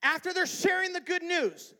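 high, loud pulpit delivery; preach at full force. Real, not acted.

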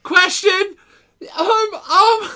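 high, loud comic interruption; hammed-up outburst. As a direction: burst in with a loud, high mock urgency. Hammed-up interjection before mock tirade.

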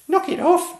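a tiny knight stopping the crowd; furious piping kid shout. A narrator's reading. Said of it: "he cried aloud".

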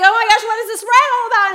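nervous giggling alarm; panicked. Retold or imitated. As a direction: laugh through the fear. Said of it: "I was like".

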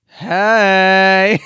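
loud bright presenter shout; chirpy radio presenter. Pretend. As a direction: boom it like a breakfast DJ. Mock chirpy presenter greeting.

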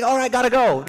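high, chirpy family hello; relative greeting. Retold or imitated. Relative on a Christmas FaceTime call, high cheerful hello. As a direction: greet him brightly on the call. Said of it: "Yes, like".

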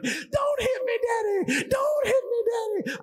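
put-on very high, loud childish shriek; hammed-up panicked wail; mock screaming. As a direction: act out a hysterical kid screaming for mercy. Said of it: "I'd just scream and yell".

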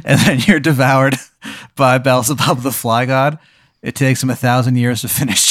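ordinary talk, nothing marked

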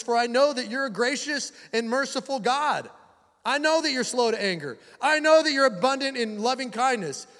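high-pitched, hammed-up indignant prayer; Jonah, angry. Prophet reproaching God for His mercy, mock-angry. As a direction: rail at God in mock anger. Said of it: "he becomes angry".

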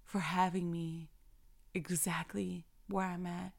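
soft, hushed prayer of thanks; quiet prayer. Pretend. Talking to God in thanks, soft and hushed. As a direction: pray it softly, close to a whisper.

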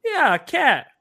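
high, cheerful host voice; a hint of the host agreeing; voiced host. Rico confirming.

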